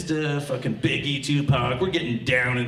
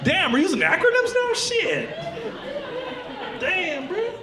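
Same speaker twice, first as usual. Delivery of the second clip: high-pitched comic disbelief; incredulous protest. Acted. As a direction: squeal it in baffled complaint. Reacting to girlfriend's wordplay.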